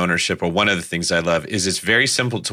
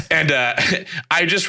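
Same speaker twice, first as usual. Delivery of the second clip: laughing; 7 semitones higher than usual. Real, not acted. Cop laughs at own story.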